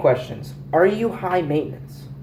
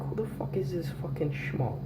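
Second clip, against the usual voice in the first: hushed, whispered disbelief; mock parental whisper; 13 dB quieter than usual. Parents shown the video, mock whispering in disbelief.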